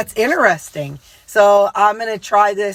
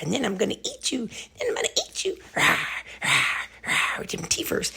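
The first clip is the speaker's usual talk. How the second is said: dog voice; 9 dB quieter, faster than usual. Acted. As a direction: whisper it like a playful big bad wolf. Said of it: "he said".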